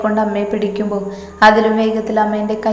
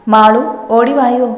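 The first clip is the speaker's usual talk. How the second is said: loud cry for help; 3 semitones higher, 9 dB louder than usual. A narrator's reading. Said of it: "ഉറക്കെയുള്ള നിലവിളി".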